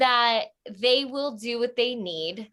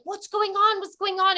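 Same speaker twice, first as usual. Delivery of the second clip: alarmed; 8 semitones higher than usual. Retold or imitated. Housemates rush out at the screaming.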